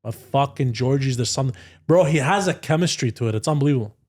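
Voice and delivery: usual speaking voice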